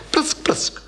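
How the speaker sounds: a voiceless cat hiss, spat out twice; cat spitting hiss. A narrator's reading. The cat-monster spits at the prince as it vanishes. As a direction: spit it like an angry cat.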